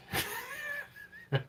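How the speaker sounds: high, sudden squeal of laughter. Real, not acted. Squealing with laughter while saying bye to viewers. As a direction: let out a shrill giggle.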